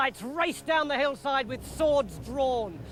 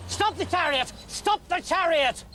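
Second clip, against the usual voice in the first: ordering; 7 semitones higher than usual. Acted. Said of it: "ordered Saul".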